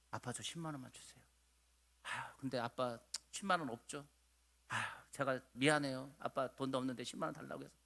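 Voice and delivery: soft, low-pitched apologetic plea; timid child asking. Acted. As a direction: ask a parent for money very meekly, in a small quiet voice. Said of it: "어느 날 자식이 와서".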